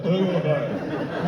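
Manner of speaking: slow London drawl, only vowels; London accent. Shown as a demonstration. Comedian showing how Londoners talk, exaggerated London accent. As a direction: drop every consonant in mock London speech. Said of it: "No consonants, only vowels".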